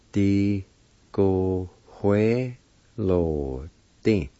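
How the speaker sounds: "Di, go, hue, lo, di" with long gaps; each syllable of "Di, go, hue, lo, di" drawn out alone; broken into syllables. Shown as a demonstration. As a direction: sound out "Di, go, hue, lo, di" slowly. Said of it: "It's pronounced like this".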